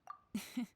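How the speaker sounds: short laugh. Acted. S1 laughs after a question.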